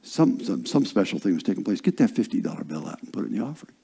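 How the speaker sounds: low, soft, quick divine prompting; quiet inner voice. Retold or imitated. Spirit prompting preacher during a service, quiet inner voice. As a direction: murmur it low like a nudge from within. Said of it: "like the Spirit of God says".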